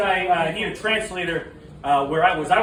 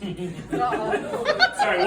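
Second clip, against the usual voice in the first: normal talking voice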